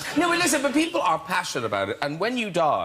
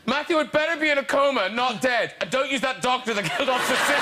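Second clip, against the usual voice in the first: indignant fan; 5 semitones higher than usual. Acted. Reads out an indignant fan's tweet.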